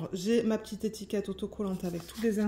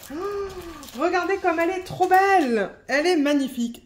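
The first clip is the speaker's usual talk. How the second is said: excited; 8 semitones higher, 5 dB louder than usual. Real, not acted. Delighted at the finished image.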